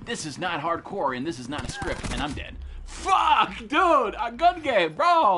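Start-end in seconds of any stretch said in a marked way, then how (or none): 2.9-5.4 angry shout. Real, not acted. Killed, raging at gun game.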